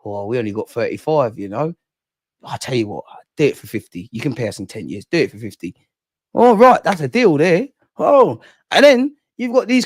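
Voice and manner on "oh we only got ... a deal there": acted haggling. Clubs haggling over what you lot wanna pay, low-key dealing. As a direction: play a discreet deal-maker, pitched down. Acts out both sides of haggling.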